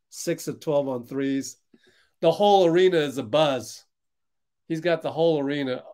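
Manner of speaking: ordinary delivery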